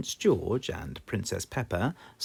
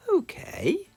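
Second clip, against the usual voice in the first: much higher mum voice; a pitched-up mother agreeing; Mummy Pig; 3 dB louder than usual. Acted. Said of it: "says Mummy Pig".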